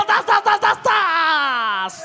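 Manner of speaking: high, loud rapid chant; excited shout. Real, not acted. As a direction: chant the kills in a frenzy. Caster hype at kills.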